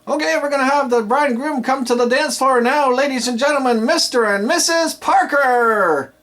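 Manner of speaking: high, loud emcee call stretching the names; enthusiastic build-up. Shown as a demonstration. DJ calling the bride and groom to the dance floor, hyped. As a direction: build it up and land on the couple's names. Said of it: "So let's try this".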